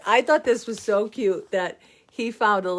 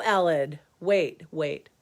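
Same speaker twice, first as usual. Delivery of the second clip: ordinary talk, nothing marked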